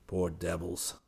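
Poor devils muttered softly under the breath. Acted. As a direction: mutter Poor devils to yourself walking away. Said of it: "hurried away muttering".

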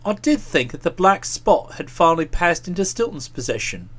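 low, reflective murmur to oneself; quietly pondering, low voice; musing. A narrator's reading. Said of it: "still musing".

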